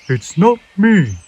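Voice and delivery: the owl hoots a slow denial; hooting owl. A narrator's reading. Owl hooting at Peep, slow and loud. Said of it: "hooted the owl".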